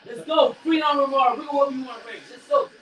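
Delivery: high, hyped fan shouting; excited cheering. Real, not acted. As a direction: cheer your fighter on. S1 cheering his fighter on.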